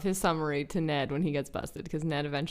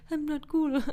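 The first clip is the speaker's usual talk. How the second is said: higher mock voice; Dewey impression; 8 semitones higher than usual. Cohost quoting Dewey's line, lightly voiced. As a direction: toss it off like Dewey. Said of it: "I love his impression".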